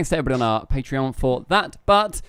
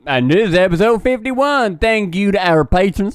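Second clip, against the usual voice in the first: hammed-up Texas accent, pitched up; Texan accent; 4 semitones higher, 3 dB louder than usual. Host thanks the patrons in a put-on Texan accent. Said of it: "in Texas with that accent".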